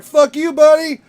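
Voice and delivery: high, loud shouted retort; mock yelled comeback. McConaughey yelling back at his unseen heckler, mock shout. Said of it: "Matthew McConaughey would be like".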